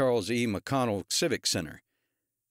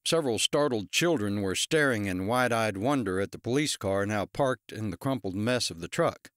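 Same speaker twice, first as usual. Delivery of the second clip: usual speaking voice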